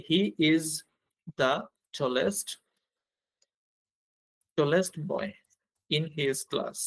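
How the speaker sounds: word by word with long pauses; halting pace, keeping time with the pen; slow dictation. Real, not acted. Teacher writing out an example sentence, slow dictation.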